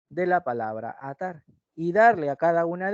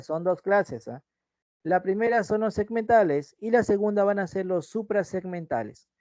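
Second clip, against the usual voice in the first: ordinary talk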